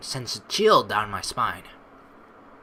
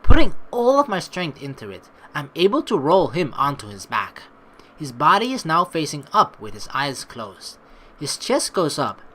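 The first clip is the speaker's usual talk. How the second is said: ordinary talk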